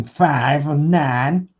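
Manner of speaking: slow, drawn-out Hull accent. Shown as a demonstration. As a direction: stretch it out in a broad Hull accent. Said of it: "my Hull accent going".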